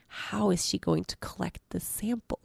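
hushed embarrassed whisper. Real, not acted. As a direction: drop to a secret whisper.

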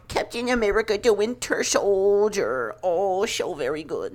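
high, slurred mock-whiny voice; mocking naysayers. Host mimicking rival Marvel fans, mocking. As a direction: put on a silly high voice for dim fans. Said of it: "I don't wanna hear".

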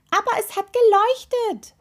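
high, loud insistent protest; contradicting. A narrator's reading. Toe contradicting the doubting witch, insistent and high. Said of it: "widersprach der Zeh".

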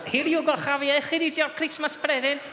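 high, loud Spanish dad voice; cheerful Spanish father, heavy accent. Acted. Spanish father giving son Christmas tickets, Spanish accent. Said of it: "Perfectly serviceable Spanish accent".

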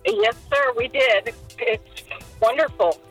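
ordinary talk, nothing marked

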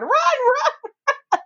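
high, frantic cries of run; hammed-up panicked shout; mock panicked yell. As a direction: imitate a man fleeing a monster. Arts fleeing the monster, yelling.

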